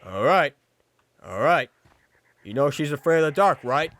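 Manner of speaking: hint of a gruffly satisfied movie dad. Movie dad warns sister's date, lightly played firm checking. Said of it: "she's the stereotypical movie dad".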